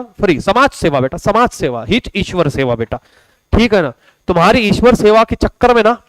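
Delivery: normal talking voice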